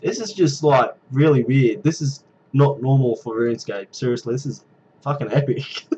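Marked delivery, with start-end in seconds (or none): none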